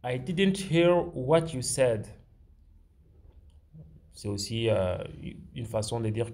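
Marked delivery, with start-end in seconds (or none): 0.0-2.1 1 slow, careful classroom demo. Shown as a demonstration. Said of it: "vous pouvez aussi dire".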